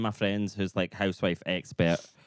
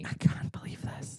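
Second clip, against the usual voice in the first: soft, slower shocked whisper; 7 dB quieter than usual. Pretend. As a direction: whisper it in disbelief. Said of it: "mock shock whisper".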